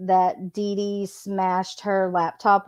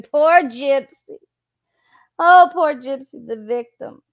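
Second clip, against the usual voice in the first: exaggerated, sarcastic sympathy; mock pity; 2 semitones higher, 4 dB louder than usual. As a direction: pity a self-made victim, punching the first words then dropping away.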